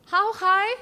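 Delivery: high and loud; high pitch. Shown as a demonstration. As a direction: jump up high on high. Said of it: "What's pitch?".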